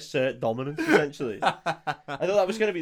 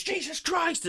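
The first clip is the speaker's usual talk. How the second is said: high-pitched, mock-exasperated cry; mock outburst; 14 semitones higher, 4 dB quieter, slower than usual. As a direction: blurt it out high, imitating a man losing it. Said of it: "slams his hand on the desk and just goes".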